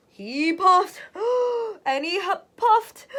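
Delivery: gasping between high, loud puffs; out of breath. Acted. Wolf wears himself out blowing, gasping for breath. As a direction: run out of air trying to blow.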